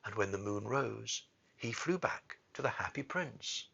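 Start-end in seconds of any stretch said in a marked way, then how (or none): none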